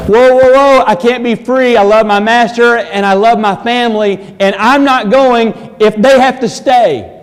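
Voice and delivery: high, loud, urgent refusal of freedom; servant pleading. Acted. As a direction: plead loudly to stay with the family.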